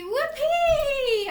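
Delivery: high, loud whoops of joy between squats; excited whoopee. Pretend. Yoga teacher leading kids in jumping squats, cheering whoops. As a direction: cheer like you just found the carrot. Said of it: "Pretend you're excited".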